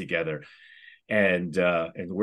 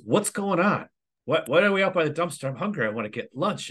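high-pitched mock bewilderment; baffled complaint; 10 semitones higher than usual. Assistant principal finding colleagues by the dumpster, baffled. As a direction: imitate a puzzled colleague butting in, voice up.

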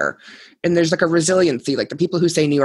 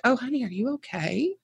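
imitating a kind stranger, higher and softer; sympathetic passerby; 8 semitones higher, 7 dB quieter than usual. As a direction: coo it like a concerned Boston local asking if she's okay. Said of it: "people are like".

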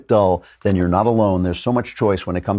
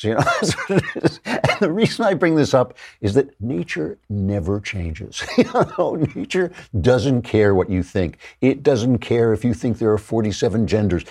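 ordinary delivery

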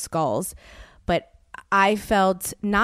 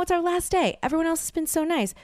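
usual speaking voice

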